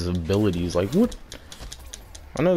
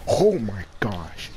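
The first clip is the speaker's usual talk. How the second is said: slow, breathy whispered disbelief; stunned whisper at the boss's move; slower than usual. Real, not acted. Disbelief at the boss.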